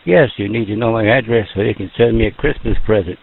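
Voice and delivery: ordinary delivery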